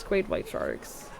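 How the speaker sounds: low, slow dread about sharks; ominous low. Real, not acted.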